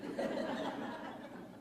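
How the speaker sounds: a soft, voiceless burst of laughter; breathy laugh. Real, not acted. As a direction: let a quiet amused laugh escape. Laughs at the flat spiel.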